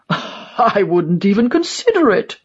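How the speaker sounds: put-on scoffing old die-hard; old man's character voice, appalled at the idea; disgusted. A narrator's reading. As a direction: reject it with an indignant huff. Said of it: "He frowns and pulls his neck in like a turtle in disgust".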